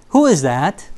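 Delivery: ordinary delivery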